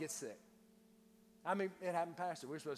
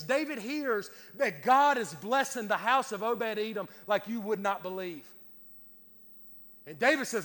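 loud, higher-pitched pulpit delivery; forceful preaching; 5 semitones higher, 8 dB louder than usual. Real, not acted. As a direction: drive the story home to the congregation.

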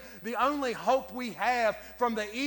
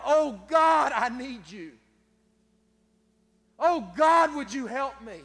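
loud cry of need to God; 2 semitones higher, 7 dB louder than usual. Retold or imitated. Preacher voicing David crying out in the cave, loud. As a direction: call out, desperate, from the cave. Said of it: "just cried out".

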